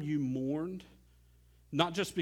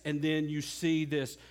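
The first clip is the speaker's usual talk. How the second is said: ordinary talk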